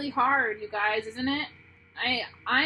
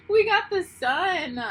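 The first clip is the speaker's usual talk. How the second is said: high, louder, slow reveal; delighted; 9 semitones higher, 8 dB louder than usual. Real, not acted. Reader thrilled to show viewers the sun card. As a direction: announce the sun card with joy.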